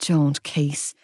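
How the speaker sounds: low, weary grumble; grumbled. A narrator's reading. Friend refusing to discuss her twin brother, grumbling. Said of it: "I grumbled".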